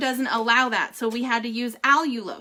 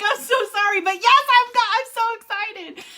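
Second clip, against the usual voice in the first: high, loud gushing joy; giddy excitement; 9 semitones higher, 5 dB louder than usual. Retold or imitated. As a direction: squeal it out, barely containing it. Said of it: "I was like".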